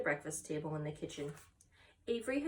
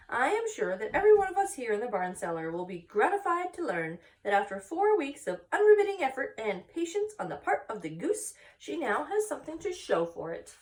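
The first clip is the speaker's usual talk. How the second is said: raised, formal speech voice; 6 semitones higher, 6 dB louder than usual. Acted. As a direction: orate it like a proud announcement. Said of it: "She made a speech".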